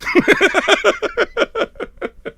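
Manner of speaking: long, high burst of laughter; high-pitched laugh. Real, not acted. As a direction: laugh it out after the rant. Laughs after finishing his rant.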